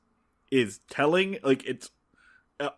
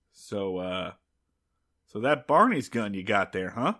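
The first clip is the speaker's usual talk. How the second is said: casual challenge in a lowered voice; super masculine; 7 semitones lower than usual. Retold or imitated. Lawman sizing up the gunman, super-masculine understatement. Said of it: "super masculine is just like".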